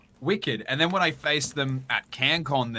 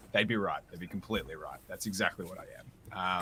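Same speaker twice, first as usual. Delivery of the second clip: much softer, smug, trailing off; mock messiah, fading to a hush; 6 semitones lower, 12 dB quieter, faster than usual. Guest jokes he is a messiah, softly smug. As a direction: let the self-praise sink to a near hush. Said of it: "I'm a messiah".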